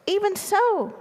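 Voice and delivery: high-pitched, insistent mouse; pleading mouse. A narrator's reading. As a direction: push back in a high little voice. Trapped mouse pleads with giraffe.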